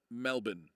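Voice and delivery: Melbourne as MEL-bin; Aussie pronunciation. Shown as a demonstration. Streamer repeats his corrected word, Melbourne said MEL-bin. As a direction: clip Melbourne's second syllable to "bin", no R. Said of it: "was how to pronounce".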